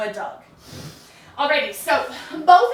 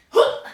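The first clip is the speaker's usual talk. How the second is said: louder playful whoop sound; mock whoop; 6 dB louder than usual. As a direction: toss off a quick cheeky whoop. Imitating a lazy flip of the feet.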